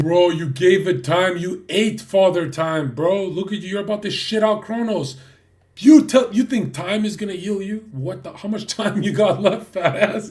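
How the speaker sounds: loud mocking rant; shouting. Real, not acted. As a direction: yell it down at him, taunting.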